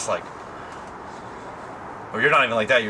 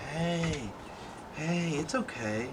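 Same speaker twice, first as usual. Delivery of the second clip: much softer, higher, slow calming voice; soothing the dog; 5 semitones higher, 11 dB quieter, much slower than usual. Real, not acted. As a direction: gently settle a nervous pet.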